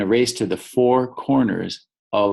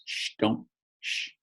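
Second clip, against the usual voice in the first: soft acted shushing; hushing; 9 dB quieter than usual. A narrator's reading. Rabbit hushes the cousins.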